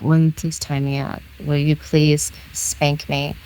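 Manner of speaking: soft, halting ashamed asking; near whisper. Retold or imitated. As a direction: force the words out quietly, embarrassed. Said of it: "almost like a whisper".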